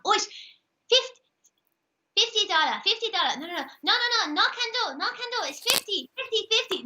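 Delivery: high-pitched Chinese-accented market haggler. Shown as a demonstration. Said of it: "Chinese. You gotta do Chinese".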